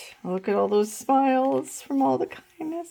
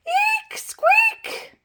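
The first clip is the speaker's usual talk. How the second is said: very high squeaky shriek; teacher on a chair squealing at gerbils; squeal of fright. A narrator's reading. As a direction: shriek like a startled mouse. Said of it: "she cried".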